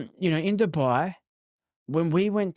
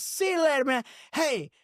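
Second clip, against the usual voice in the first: imitating excitable stranger; 10 semitones higher than usual. Imitating excitable man leaving.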